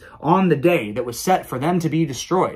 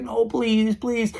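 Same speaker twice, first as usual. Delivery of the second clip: begging Haman; 9 semitones higher, much slower than usual. Retold or imitated.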